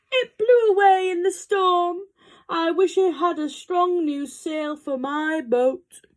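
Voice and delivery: loud, high goat voice; bleating goat. A narrator's reading. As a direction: wail like a goat in trouble. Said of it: "who was bleating loudly".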